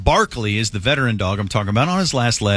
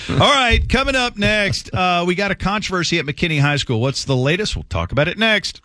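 radio host read; 5 semitones higher than usual. Acted. Radio segment outro.

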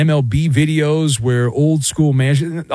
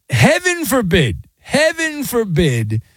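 mock horror; 7 semitones higher, slower than usual. Mock outrage, drawn out.

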